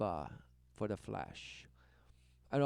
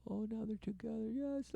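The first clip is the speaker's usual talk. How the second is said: high, soft, syrupy mock delight; mock cooing; 10 semitones higher, 4 dB quieter than usual. Cooing over the newlyweds, mock delight. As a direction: coo over them in a put-on high voice.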